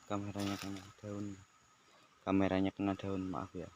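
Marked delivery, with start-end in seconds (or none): 2.3-3.2 repeated much louder. Real, not acted. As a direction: say it again, loud and clear. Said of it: "Eh, maaf".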